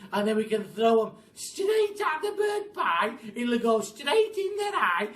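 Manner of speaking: put-on loud, high nasty old woman; Missus Twit. A narrator's reading. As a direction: gloat over the pie plan.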